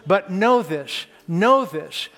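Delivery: slow and strong, pressing each phrase; preaching. Acted. Preacher assures the congregation that God helps, preaching slowly and forcefully. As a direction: drive it home from the pulpit.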